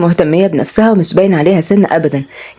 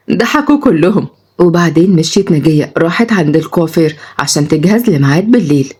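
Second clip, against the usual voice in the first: ordinary delivery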